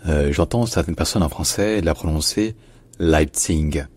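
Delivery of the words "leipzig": Leipzig ending in a hard G; French mispronunciation of Leipzig, final g sounded; mispronounced final G. Shown as a demonstration. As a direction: say Leipzig with a voiced g at the end. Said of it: "ça ne se prononce jamais comme ça".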